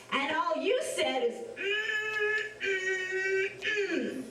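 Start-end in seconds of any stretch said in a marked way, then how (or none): 1.6-4.2 high mm mm mm, lips shut; closed-mouth hum. Shown as a demonstration. Storyteller showing the alligator's closed-mouth hum.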